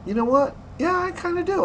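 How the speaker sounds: surprised friend. Retold or imitated. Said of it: "he says".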